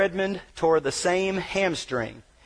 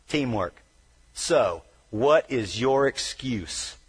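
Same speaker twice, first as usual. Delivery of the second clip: usual speaking voice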